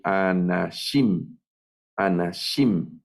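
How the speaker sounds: anashim said slowly, stress on the final -shim; stress on the last syllable. Shown as a demonstration. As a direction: draw out and stress the end: a-na-SHIM. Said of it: "Hacia el final va el acento". (anashim con acento en la última sílaba)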